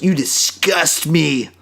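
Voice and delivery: slow, hammed-up disgust; mock outrage. Co-host ends a joke rant about a cowardly episode, mock outrage. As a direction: draw it out in pretend revulsion.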